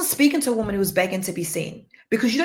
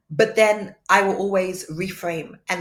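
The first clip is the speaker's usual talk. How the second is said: ordinary talk, nothing marked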